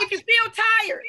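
preacher rallying a tired congregation; rousing call. Real, not acted. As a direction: lift them up with the line.

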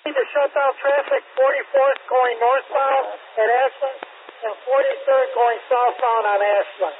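urgent call over police radio; radio transmission from the scene. Real, not acted. Officer calling for traffic closure.

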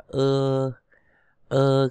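long, level Ơ vowel; E read as Ơ. Shown as a demonstration. Teacher shows learners how E is read, a drawn-out Ơ. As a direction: hold Ơ steadily for the letter E. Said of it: "cái chữ E đọc như Ơ".

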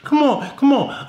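high, loud panicky denial; frantic pleading. Acted. Boyfriend to girlfriend, high panicky pleading. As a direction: beg her to believe you, flustered.

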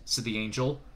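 plain reading voice

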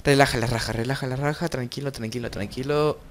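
calm down, easy, quietly; calming himself. Real, not acted. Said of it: "Deja que se me relaje un poco".

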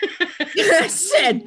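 high, loud laugh spilling into the words. Real, not acted. Grandmother laughing as she retells her story. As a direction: laugh through the lead-in.

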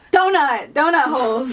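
high, loud burst of triumph; excited loud guess. Real, not acted. Player in headphones finally guesses the phrase, excitedly loud. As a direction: shout the answer as it clicks.

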